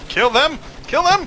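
frantic shout. Real, not acted. Firefight, calling out.

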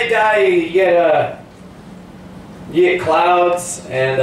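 usual speaking voice

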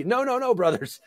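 high-pitched, imitated pleading refusal; frantic protest. Retelling Paul stopping the bull sacrifice, imitated frantic protest. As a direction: voice leaps up as if stopping them.

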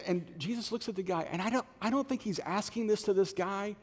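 usual speaking voice

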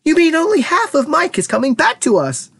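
high, horrified shriek; scream of horror. A narrator's reading. Mother hearing half her son returns, screaming in horror. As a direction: shriek the question, aghast. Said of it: "let out a scream of horror".

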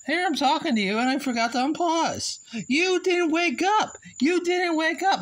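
worked up over a blunder; exasperated outburst. Real, not acted. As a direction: build to a high wail of frustration. Upset at forgetting to unpause.

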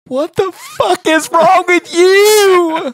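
very high, loud, slow strangled cry; dad losing it in the hallway, hammed-up high shout; mock exasperated outburst. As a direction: overblown frustration, stretched out. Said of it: "under my breath going".

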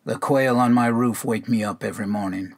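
ordinary delivery

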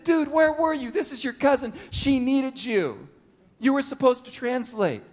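high, pressing reproach to a late friend; mock-chiding where were you; exasperated scolding. Scolding the absent translator.

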